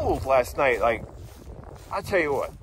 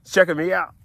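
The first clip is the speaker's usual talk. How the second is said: ordinary talk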